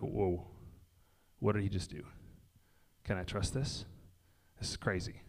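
low, hushed, stunned murmur fading almost to a whisper; hushed awe. Retold or imitated. Disciples backing away from Jesus in the boat, hushed and awed. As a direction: mutter it like you're backing away from something you can't explain. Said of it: "taking steps back of, like".

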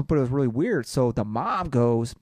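usual speaking voice

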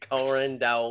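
light mock Italian-Southern "Corn dogs"; Italian doing Southern. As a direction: echo the actress's faked Southern "Corn dogs". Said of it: "she tries to sound like an Italian doing a Southern accent".

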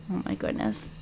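breathy under-the-breath sigh of a whisper. Real, not acted. Exasperated owner whispering about her snoring dog, whispered. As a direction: whisper it to yourself, unvoiced.

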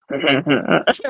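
quiet man's chuckle, imitated; Uncle Jerry laugh. Niece shows how uncle laughs, imitated quiet chuckle. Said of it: "like, quiet man laugh?".

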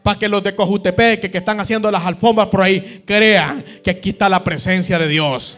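loud, fervent preaching to the congregation; impassioned pastor rallying his church; preaching at full force. Acted. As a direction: drive the call to unity home from the pulpit. Preaching the climax of the sermon.

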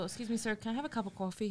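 softly retold polite request. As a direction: ask softly, like a timid customer. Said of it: "I felt embarrassed".

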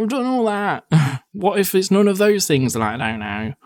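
high, fed-up retort breaking into a laugh; exasperated. Retold or imitated. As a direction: sound done with everyone's advice. Retorting to online advice.

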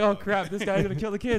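high, loud mock alarm; mock panic. The viewer panicking that the neighbor will hurt the kids, in mock alarm. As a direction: squeak it in fake terror. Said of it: "You're like".

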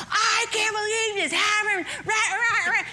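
high, hammed-up parental rant; mock tantrum. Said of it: "I had a tantrum".

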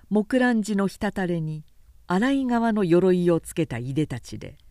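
ordinary delivery, nothing marked

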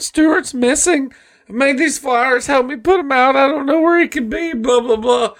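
high-pitched mock tearful plea; crying mom. Mom seeking her missing son, high tearful imitation. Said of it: "Stewart's mom shows up crying".

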